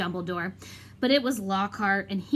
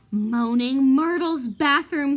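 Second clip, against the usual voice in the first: slow, louder, each word landing; the penny finally drops; stunned realization; 3 semitones higher, 5 dB louder, slower than usual. Acted. Climax of realization after gasp.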